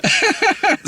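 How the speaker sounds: sudden high laugh; high burst of laughter. Real, not acted. Laugh at friends.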